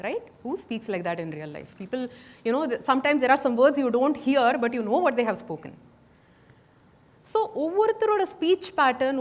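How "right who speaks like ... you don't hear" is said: ordinary talk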